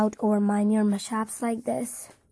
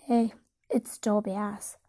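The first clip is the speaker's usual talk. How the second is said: plain reading voice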